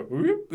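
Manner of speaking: high-pitched comic "whoop"; imitated whoop. Mimicking a wrestling sweep for a friend, high comic whoop. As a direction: let out a sudden high whoop for a move.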